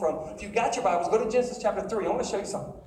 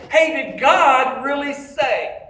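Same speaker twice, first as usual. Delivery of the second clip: loud, slow, emphatic challenge; tempting voice, louder and higher; serpent's challenging voice; 4 semitones higher, 10 dB louder, much slower than usual. Acted. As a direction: boom the tempter's question out slowly. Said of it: "she's hearing a voice that says".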